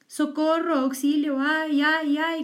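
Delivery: high, loud cries for help; wolf crying for help. A narrator's reading. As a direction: howl out for help like a trapped animal. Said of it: "gritaba el lobo".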